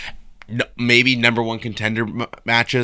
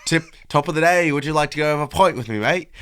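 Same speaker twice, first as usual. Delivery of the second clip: put-on jokey Irish accent, pitched higher; mock Irish accent; 4 semitones higher than usual. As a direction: play up a cartoon Irish pub voice, higher. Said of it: "Let me get my Irish voice warmed up".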